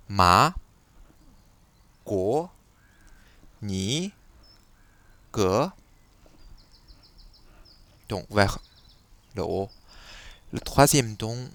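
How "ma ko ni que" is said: «Ma, ko, ni, que» au deuxième ton, chaque syllabe montant; rising second tone on Ma, ko, ni, que; deuxième ton chinois. Shown as a demonstration. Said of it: "un ton levé". (deuxième ton montant)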